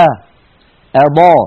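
أضاء with a thin hamza; light hamza. Shown as a demonstration. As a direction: say آ then أضاء with a light, unrounded start. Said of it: "بل تقول العرب".

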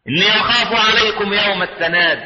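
sustained, measured verse chant; Quranic recitation. Acted. Preacher warning of the Day of Calling, recited as scripture. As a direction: recite it with drawn-out vowels.

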